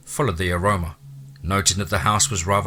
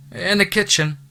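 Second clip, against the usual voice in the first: higher woman's voice calling from the next room; woman calling back; 11 semitones higher than usual. A narrator's reading. Housekeeper calling to the newcomer from the kitchen, higher voice. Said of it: "a woman's voice called back".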